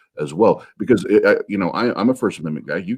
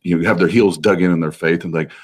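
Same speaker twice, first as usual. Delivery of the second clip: normal talking voice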